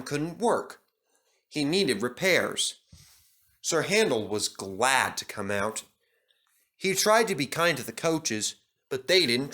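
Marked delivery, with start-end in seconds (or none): none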